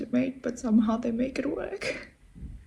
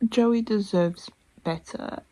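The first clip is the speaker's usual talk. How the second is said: ordinary talk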